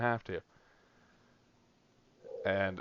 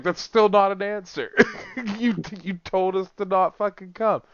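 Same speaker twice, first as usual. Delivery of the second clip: indignant, raised and higher, breaking into a laugh; retold angry retort; 8 semitones higher, 3 dB louder than usual. Worker retelling how he snapped at his boss, indignant. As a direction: snap back at the boss in mock outrage.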